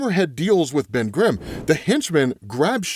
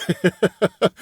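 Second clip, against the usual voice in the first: short run of chuckles; pleased little laugh; amused laugh; 2 semitones higher than usual. Real, not acted. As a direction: laugh at your own bit. Amused at own vindication line.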